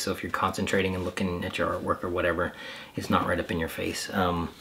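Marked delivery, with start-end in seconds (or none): none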